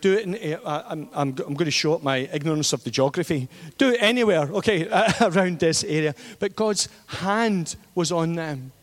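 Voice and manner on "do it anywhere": excited. Retold or imitated. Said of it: "I'm like".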